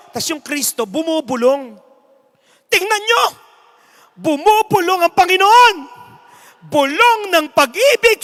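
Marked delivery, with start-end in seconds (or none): 2.7-8.2 high, loud preacher-like shout; shouting announcer. Acted. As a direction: whip the crowd into tears. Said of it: "Sabi ng announcer".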